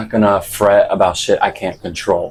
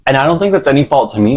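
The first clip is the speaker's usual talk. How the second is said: excited fast; 6 semitones higher, 4 dB louder, much faster than usual. Real, not acted. Defensive aside.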